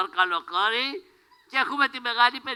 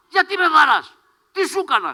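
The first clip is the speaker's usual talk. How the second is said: outraged protest; 7 semitones higher, 7 dB louder than usual. Acted. Character protests being hit.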